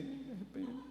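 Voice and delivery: put-on little boy's voice. Small boy answering pastor.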